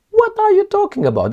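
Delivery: shrill leap up in disbelief; incredulous. Real, not acted. Commentator scoffing at the West's denial, incredulous high outburst. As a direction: throw the question back, astonished.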